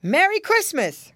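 high, loud, hearty call; cried greeting. A narrator's reading. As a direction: visitor hailing the house from the door. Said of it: "cried a voice at the door".

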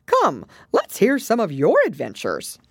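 lightly voiced creature, high and loud prompt; Gryphon.

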